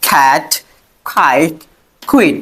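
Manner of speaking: খ্যাট খাইট কুইন said with kh; aspirated k demo. Shown as a demonstration. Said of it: "খয়ের মতো।".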